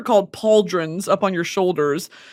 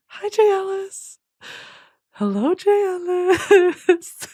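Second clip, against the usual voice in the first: flirty; 9 semitones higher, slower than usual. Pretend. Flirting with actor on screen.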